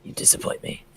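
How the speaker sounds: mock disappointed whisper. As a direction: breathe it out in pretend disapproval. Teasing friend, then "I'm kidding.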